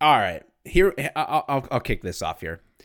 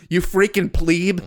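slow, loud, high put-down of a trainee; mock drill-sergeant insult; 8 semitones higher, 8 dB louder, slower than usual. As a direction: belt out the insult like a tough training officer. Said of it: "I did that to my trainees".

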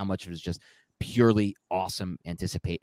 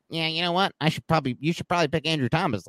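higher pitched nerdy football purist; football nerd voice; 8 semitones higher, faster than usual. Retold or imitated. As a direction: play a know-it-all football nerd, higher. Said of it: "like, football nerd voice. ... it was terrible".

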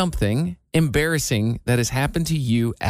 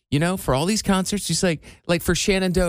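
ordinary delivery

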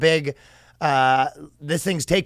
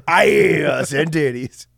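slow mock shout; 4 dB louder, much slower than usual.

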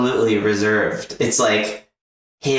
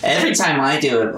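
usual speaking voice

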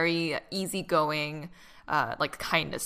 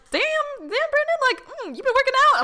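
much higher, teasing sing-song admiration; mock flirty catcall; 6 dB louder than usual. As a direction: ham up a flirty tease of a buff brother. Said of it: "You're like".

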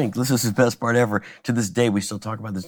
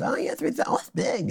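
higher, softer half-whispered Jay voice; imitating Jay; 7 semitones higher, 5 dB quieter than usual. Jay reacts to the room size in a higher mock voice. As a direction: breathe it out higher, impressed. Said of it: "he says".

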